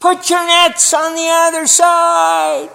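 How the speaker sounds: a slow, high call across the water to the boat; calling out from the beach. Retold or imitated. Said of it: "And he shouts".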